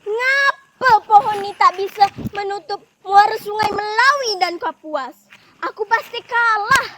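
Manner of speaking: loud, high exasperated outburst; very frustrated. Acted. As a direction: wail in annoyance that the plan has failed. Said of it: "Indai Simpan pun terlihat sangat kesal".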